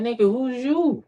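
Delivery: God, scoffing at their sense of entitlement, lightly voiced; God's dismissal. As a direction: brush them off like God would. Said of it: "God looking at you like".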